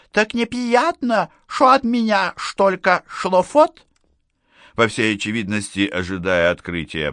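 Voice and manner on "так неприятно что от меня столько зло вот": slow, high, slurred toothless lisp; drunk lisping old woman. Acted. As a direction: slur it like a tipsy old widow. Drunk widow speaks lisping. (пьяная шепелявая старуха)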